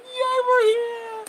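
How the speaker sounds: high, slow, tearful squeal; mock tearful cheer. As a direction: squeal it through happy tears. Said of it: "We're like".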